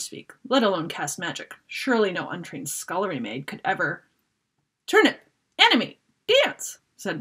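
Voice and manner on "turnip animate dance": high, loud incantation; casting a spell. Acted. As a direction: chant the command brightly. Mop casts the spell.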